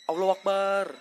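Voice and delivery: loud startled cry of Allahu Akbar; startled shout. Real, not acted. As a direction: shout it out in sudden fright.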